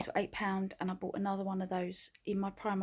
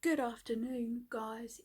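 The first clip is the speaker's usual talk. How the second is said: ordinary delivery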